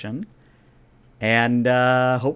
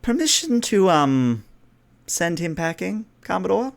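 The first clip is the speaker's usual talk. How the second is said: higher-pitched, delicate princess request; polite princess; 7 semitones higher than usual. Acted. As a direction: ask the Commodore's leave sweetly. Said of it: "the princess says".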